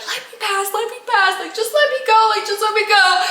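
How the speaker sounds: high, urgent repeated pleading; frantic retold plea. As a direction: plead to be let through, voice pitched high. Herself begging to get out.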